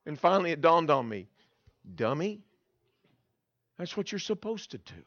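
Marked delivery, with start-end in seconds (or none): 2.0-5.0 soft self-chiding; murmured to yourself after a pause; quiet realization. Retold or imitated. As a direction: let it dawn on you, hushed. Quiet realization, talking to himself.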